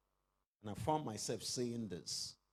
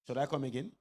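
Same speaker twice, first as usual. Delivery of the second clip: normal talking voice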